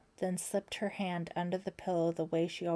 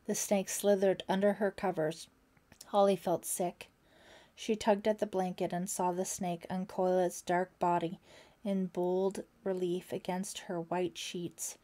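ordinary delivery

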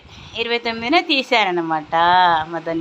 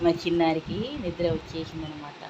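softer, fast hushed aside; 3 semitones lower, 8 dB quieter than usual. Real, not acted. Mother notes her little one is sleepy, hushed aside. Baby falling asleep.

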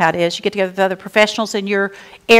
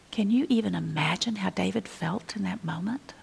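softer, breathy whisper to the listeners; a pastor hushes the congregation to a whisper; hushed whisper; 12 dB quieter than usual. Real, not acted. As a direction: draw them in with a whisper. Imagining David's moment of guilt.

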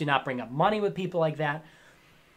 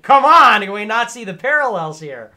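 loud, raised exclamation; exasperated outburst; 4 semitones higher, 10 dB louder than usual. Real, not acted. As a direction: burst out in exasperation. Exasperated appeal to see parallels.

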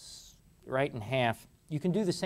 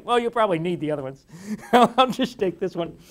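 laughing; 5 semitones higher, 7 dB louder than usual. Real, not acted. Retelling a joke to his father.

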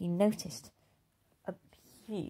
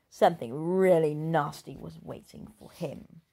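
ordinary delivery

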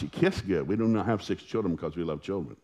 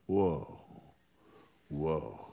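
smitten; 4 semitones lower, 5 dB quieter than usual. Retold or imitated. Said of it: "I was smitten".

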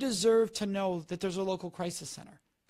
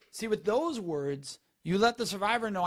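ordinary talk, nothing marked

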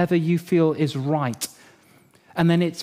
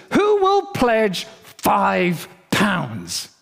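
slow, raised preacher's appeal; father calling for pledges; 4 semitones higher, much slower than usual. Retold or imitated. Dad asking church for gift day pledges, slow calling-out. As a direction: draw it out, pitched higher, to the congregation.

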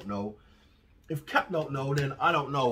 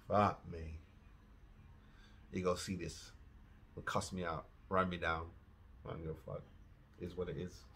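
muttered resignation; 10 semitones lower, 16 dB quieter than usual. Real, not acted. Dreading the reaction, resigned.

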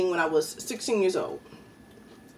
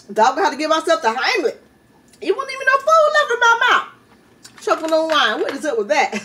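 high, louder, rattled after a coughing fit; flustered after choking; 7 semitones higher, 4 dB louder than usual. Real, not acted. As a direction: talk it out, still shaken from choking.

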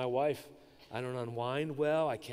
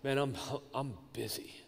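weary; 6 dB quieter than usual. Acted. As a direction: sigh it out as if drained. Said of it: "said to you like this".

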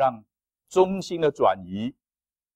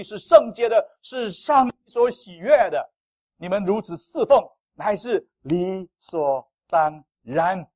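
rising, louder solemn scripture reading, slowing to the end; 5 semitones higher, 6 dB louder, slower than usual. Acted. Pastor reads Romans to the congregation, solemn and building. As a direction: proclaim the verse slowly and grandly.

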